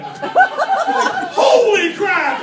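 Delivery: mock yelp. Teen on the rope gets rope burn, loud yelp. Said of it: "gets rope burn".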